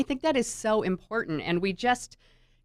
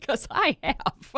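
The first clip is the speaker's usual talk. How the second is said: amused confession; 5 semitones higher, slower than usual. Real, not acted. Confesses to manipulating, laughs either side.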